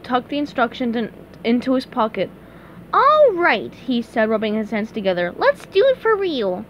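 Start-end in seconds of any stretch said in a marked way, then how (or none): 2.9-3.7 high, loud cartoon enthusiasm; SpongeBob eager. A narrator's reading. 5.4-6.6 high-pitched cartoon keenness; SpongeBob eager. A narrator's reading.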